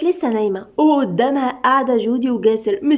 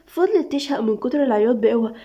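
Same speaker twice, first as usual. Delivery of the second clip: ordinary delivery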